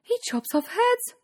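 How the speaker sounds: high, appalled Cindy voice; shocked thought. A narrator's reading. Cindy realises prince chops heads, high shock. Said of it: "she thought".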